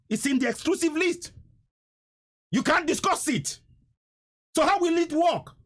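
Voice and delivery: high-pitched, indignant challenge; exasperated outrage. Real, not acted. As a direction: throw it at the listeners in exasperation, pitch climbing high.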